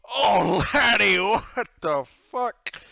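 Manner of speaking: high, loud, slow outraged yell in the captain's voice; disgusted pirate captain. Acted. Pirate captain yells at the player who puked, loud and slow. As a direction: roar it in disgust as the old captain. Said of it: "And Captain Myers goes".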